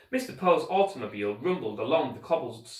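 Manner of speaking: plain reading voice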